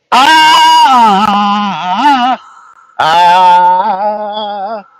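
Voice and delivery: high, loud wrestler chant; hammed-up wrestler war chant; Kamala war cry. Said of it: "I giggled a little bit".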